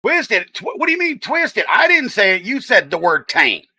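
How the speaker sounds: high-pitched, incredulous comeback; indignant protest. Real, not acted. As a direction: throw the blame back, high and emphatic. Playful argument with chatter over a word.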